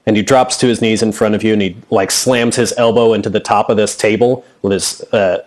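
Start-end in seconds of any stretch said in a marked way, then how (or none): none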